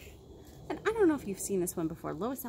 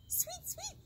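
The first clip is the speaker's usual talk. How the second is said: much higher and much softer, chirpy; bird chirp; 11 dB quieter than usual. Acted. Reading to kids, voicing the bird, soft high chirp. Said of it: "said Bird".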